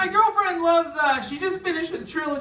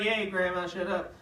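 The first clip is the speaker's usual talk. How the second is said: lower, softer muttered put-down; low mumbled mock insult to girlfriend; low grumble; 10 semitones lower, 9 dB quieter than usual. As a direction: grumble it under your breath. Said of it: "I'm just like".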